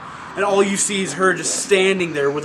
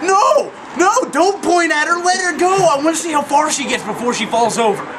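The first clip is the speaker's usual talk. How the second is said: high, loud excited yelling; excited shouting; 10 semitones higher, 4 dB louder than usual. Retold or imitated. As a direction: shout at her to stop pointing. Yelling at the pointing woman.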